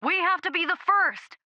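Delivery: high-pitched shout at no great volume; narrated high, insistent shout. A narrator's reading. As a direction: cry it out urgently over comms. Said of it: "Taryn shouted".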